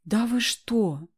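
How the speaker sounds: slow, stunned disbelief; shocked. Acted. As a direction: drag it out in shock. Shocked at news of hanging.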